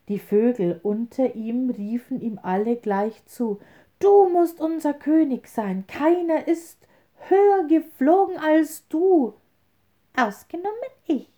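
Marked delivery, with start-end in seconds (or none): 4.0-9.3 high, louder chorus call; excited bird crowd. A narrator's reading. Birds call to eagle. 10.2-11.3 very high, quick squeak; little wren's sharp shout; tiny cry. A narrator's reading. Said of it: "schrie der kleine Kerl".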